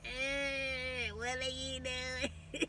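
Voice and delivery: quiet, slow, half-crying through laughter; laughing whine. Retold or imitated. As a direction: giggle it out helplessly. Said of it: "And I was like".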